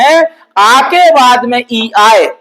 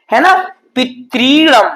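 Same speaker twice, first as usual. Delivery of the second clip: usual speaking voice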